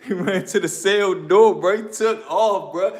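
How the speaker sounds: normal talking voice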